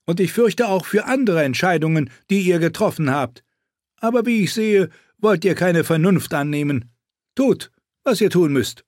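lightly voiced stern lord; haughty speaker. Voices a figure in the story.

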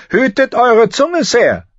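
high, loud sharp warning; queen warning. A narrator's reading. Cersei warning Barristan, high and loud. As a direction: warn him off as an angry queen. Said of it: "warnte die Königin".

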